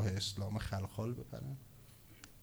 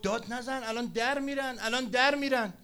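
high-pitched urgent plea; frantic protest, acted; 11 semitones higher, 4 dB louder than usual. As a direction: urge him to stop, pitch climbing. Frantic informer pleading, acted.

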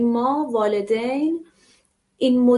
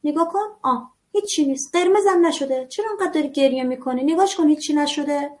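put-on higher-pitched mother brushing off the child's tears; dismissive mother; 4 semitones higher than usual. Mother telling her crying child nothing happened, brushing it off. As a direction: wave away the fuss, nothing happened.

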